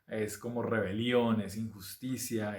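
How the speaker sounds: ordinary delivery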